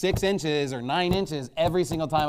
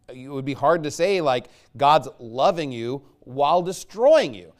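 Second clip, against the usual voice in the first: usual speaking voice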